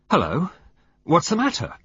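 high, sudden sharp question; sharp alarm. A narrator's reading. Douglas Gold noticing Valentine collapse, sharp and alarmed. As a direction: snap to attention. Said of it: "he said sharply".

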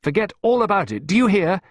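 put-on high, forceful shout at a child. A narrator's reading. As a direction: cut through the crying with urgent orders. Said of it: "Clarissa shouted at her".

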